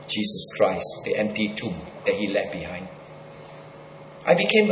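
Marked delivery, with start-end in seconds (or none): 0.1-2.9 much lower and softer, solemn; hushed reverence. Real, not acted. Preacher naming Jesus to the congregation, hushed and low. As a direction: let it fall to a reverent hush.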